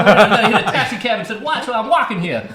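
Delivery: fast, high, laughing New York voice; New York accent. Pretend. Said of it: "That's my New York accent".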